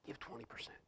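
soft unvoiced whisper of a still small voice; whispered inner voice. Pastor whispering God's still small voice to the congregation. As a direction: breathe it like a quiet inner knowing.

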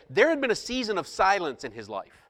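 ordinary talk

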